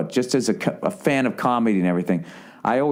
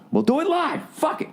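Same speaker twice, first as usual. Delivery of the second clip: higher, shouted-up "We'll do it live"; mock rant. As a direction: quote the famous outburst. Quoting famous rant.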